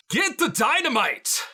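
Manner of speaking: high, urgent in-character yell; yelling to miners. Acted. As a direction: holler an order over a gunfight. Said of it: "I'm going to yell to the miners".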